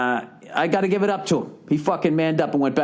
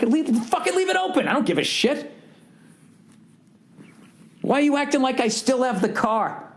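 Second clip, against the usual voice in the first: retold exasperated rant; 9 semitones higher than usual. Retold rant at rental rep.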